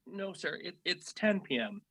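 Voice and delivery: ordinary delivery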